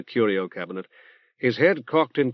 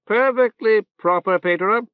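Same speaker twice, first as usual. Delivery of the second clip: reverberant nasal voice; 9 semitones higher, 5 dB louder than usual. Acted. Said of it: "in a reverberant nasal voice".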